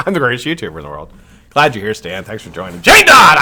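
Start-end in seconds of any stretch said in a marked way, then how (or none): 2.8-3.4 high, loud call-out of a name; excited greeting. Real, not acted. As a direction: call her name out like you spotted a friend. Greets viewer arriving in chat.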